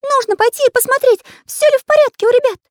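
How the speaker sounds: fast, very high agitated squeak; worried Карандаш. A narrator's reading. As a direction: squeak it urgently and loudly. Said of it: "волновался художник".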